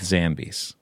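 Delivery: "zombies" with a flat a as in "cat"; odd pronunciation. Real, not acted. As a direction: say "zombies" as ZAM-bees. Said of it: "I'd like to say".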